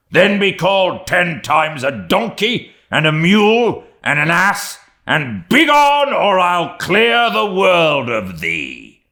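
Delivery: high, full-throated tirade of insults; furious threat. A narrator's reading. Enraged captain driving his mate away, furious shouting. Ahab advances threateningly on Stubb.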